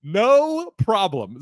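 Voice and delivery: very slow, stretched-out reply; drawn-out agreement. Retold or imitated. Man humouring his strange date, slow drawn-out agreement. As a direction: draw out the first word as you humour someone.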